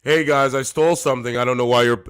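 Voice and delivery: loud, sing-song imitation of a thief; mocking the man who stole the bag; mock clueless thief. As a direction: announce the crime like it's nothing. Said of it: "I gotta save my voice".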